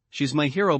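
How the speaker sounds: ordinary delivery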